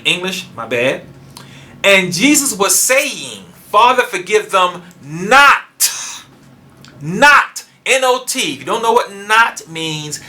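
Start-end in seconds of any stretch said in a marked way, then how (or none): 1.8-6.3 slow, high reading landing hard on "not"; stress on not. Shown as a demonstration. Preacher translating the Greek for listeners, emphatic "not". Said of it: "Key emphasis on ogar". 7.0-8.5 "Not" high and loud, then spelled out; emphatic not. Shown as a demonstration. Preacher drilling one word into listeners, emphatic. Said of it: "look up the word not".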